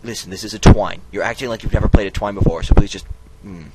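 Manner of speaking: loud, annoyed telling-off; exasperated scolding. Acted. Narrator scolds the clicking player, loud and exasperated. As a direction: snap at the player for clicking again.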